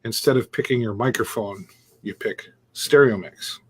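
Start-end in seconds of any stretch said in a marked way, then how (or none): none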